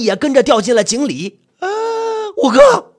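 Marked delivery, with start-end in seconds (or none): 1.6-2.9 high, loud cry for a friend; loud desperate shout. Acted. Narrator shouting after fifth brother fallen into the well, frantic. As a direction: scream out for him. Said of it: "我大声地喊着".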